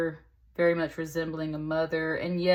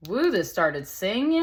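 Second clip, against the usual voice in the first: surprised; 4 semitones higher, 6 dB louder than usual. Real, not acted. Startled by sudden singing sound.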